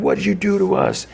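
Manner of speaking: very high mock cry; anguished accusation. Keith tackling Chris, anguished high-pitched accusation. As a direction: imitate a raging, distraught accusation. Keith attacks, retold outburst.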